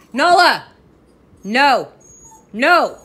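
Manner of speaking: loud sharp shouts of "Nala" and "No"; real angry shout at the dog; yelling at the dog. Real, not acted. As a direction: stop the dog right now, full volume.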